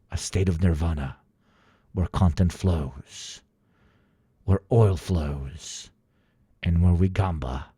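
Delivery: lightly hammed-up televangelist reading; mock sermon. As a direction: preach the subscription like scripture. That was me telling you to subscribe.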